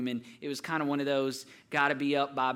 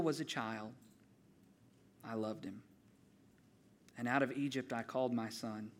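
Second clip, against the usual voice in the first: solemn scripture reading. Acted. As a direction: lay each phrase down gently like holy words. Reads Hosea scripture aloud.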